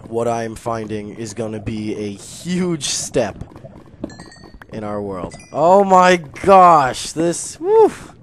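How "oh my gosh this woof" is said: loud, very slow exclamation ending in a high whoop; overwhelmed amazement. Real, not acted. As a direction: stretch it out loud, then whoop. Overwhelmed by the find.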